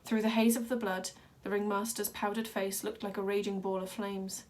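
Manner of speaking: usual speaking voice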